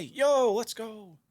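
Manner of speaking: high, delighted burst on "Yo"; excited cheer. Real, not acted. Streamer hears a viewer started playing piano, excited cheer. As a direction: cheer the good news, pitched high.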